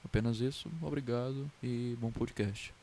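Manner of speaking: normal talking voice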